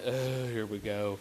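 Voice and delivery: low, soft, slow mock groan; hushed, sinking aside; dreading dad's thought. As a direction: sigh it to yourself in resigned dread. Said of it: "And I'm thinking".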